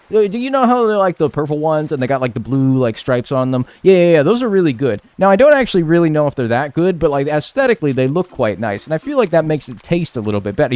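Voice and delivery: high, loud, fast fussy patient voice; imitating the patient. Patient explaining his Pop-Tart choice to the doctor, hammed-up high chatter. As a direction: ham up a rambling customer fussing over Pop-Tarts. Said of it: "he was like".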